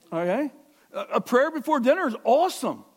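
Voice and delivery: loud, high, fervent preaching; preaching at full force. Acted. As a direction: drive it home to the congregation.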